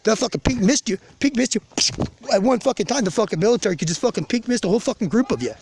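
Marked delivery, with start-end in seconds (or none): none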